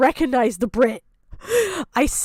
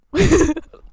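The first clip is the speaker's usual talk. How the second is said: laugh; 5 semitones higher, 4 dB louder than usual. Real, not acted. Laughs at own rant.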